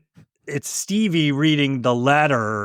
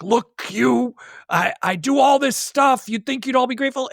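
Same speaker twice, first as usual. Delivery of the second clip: indignant retort; 7 semitones higher than usual. Retold or imitated. Acting Sarah's outburst at the aunt.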